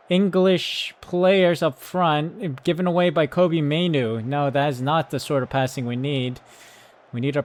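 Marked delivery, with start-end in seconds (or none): none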